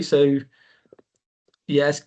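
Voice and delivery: ordinary delivery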